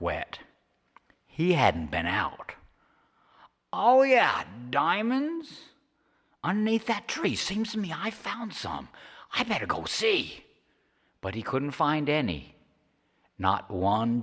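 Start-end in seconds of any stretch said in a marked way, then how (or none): 3.7-10.4 high codger talking to himself; eager Charlie. Acted. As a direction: perk up greedily. Charlie remembering diamonds.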